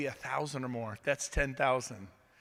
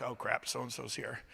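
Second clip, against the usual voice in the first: muttered dismay; 6 semitones lower, 8 dB quieter than usual. Pretend. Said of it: "you're like".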